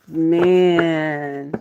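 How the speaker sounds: ordinary delivery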